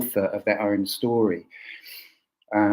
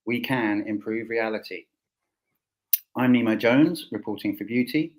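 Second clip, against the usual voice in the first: announcer intro; 3 semitones higher than usual. Acted. Scripted show opening.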